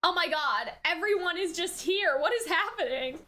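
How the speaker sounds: ordinary talk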